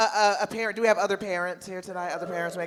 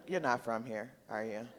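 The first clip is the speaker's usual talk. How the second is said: teasing aside; 7 semitones lower, 8 dB quieter than usual. Acted. Teasing a runner at the airport.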